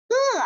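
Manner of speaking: high-starting 呃 that falls; fourth tone. Shown as a demonstration. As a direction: drop 呃 firmly downward. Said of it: "连在一起我们来说一下".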